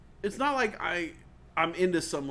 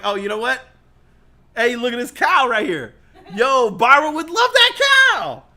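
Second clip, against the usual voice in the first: louder, rising excitement; excited shopper; 5 semitones higher, 12 dB louder than usual. Retold or imitated. Shopper spotting a Philadelphia cow for Barbara. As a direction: get more thrilled at the find. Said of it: "you're gonna be like".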